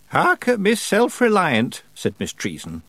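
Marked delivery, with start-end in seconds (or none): 0.1-1.8 slow, high teasing; old witch drawing out a mild jibe; gentle mockery. A narrator's reading. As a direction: tease without any nastiness. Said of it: "not in a particularly nasty way".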